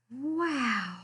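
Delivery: a lightly voiced wondering wow; amazed wow. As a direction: breathe out your amazement. Kelp amazed by unicorns.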